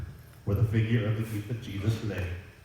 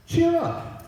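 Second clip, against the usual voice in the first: cheering up. Acted. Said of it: "the voice continued".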